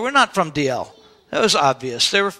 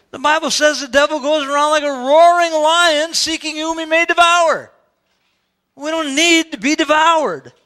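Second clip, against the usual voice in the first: preaching; 7 semitones higher, 4 dB louder than usual. Acted. Preaching at full force.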